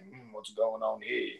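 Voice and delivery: low, soft, slow suspicious grumble; suspicious husband. Retold or imitated. Friend imitates the husband walking in on flirting. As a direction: size up the scene with suspicion.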